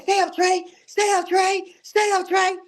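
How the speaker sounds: high, insistent imitation of a frantic father; retold urgent plea. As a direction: repeat it high, keeping him awake. Father urging injured son awake.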